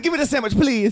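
a hint of a hungry whine; mock begging. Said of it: "He said".

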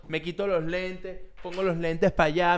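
ordinary talk, nothing marked

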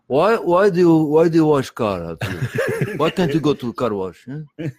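mock-scolding immigrant neighbor; angry Armenian neighbor. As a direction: needle him like the grumpy old neighbor. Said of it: "he finally said".

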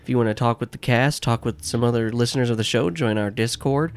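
upbeat podcast outro read; announcer read. Acted. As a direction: read the plug like a host.

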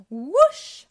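a loud rushing whoosh; big splashy sound effect. A narrator's reading. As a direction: let the water burst out. Water bursts into tunnel.

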